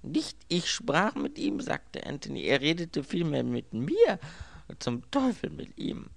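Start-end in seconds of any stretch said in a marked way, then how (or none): 0.0-1.6 high, slow, emphatic objection; indignant protest. A narrator's reading. Robbed man setting his servant straight, indignant. 2.4-6.1 high-pitched indignant outburst; exasperated cursing. A narrator's reading. Robbed man cursing the talkative thief, exasperated.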